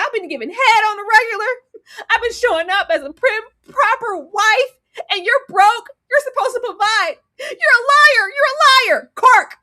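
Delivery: high, loud, tearful accusations; furious wife yelling. Retold or imitated. Wife screaming at her broke husband, loud and tearful. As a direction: scream at your broke husband through tears. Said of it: "She said".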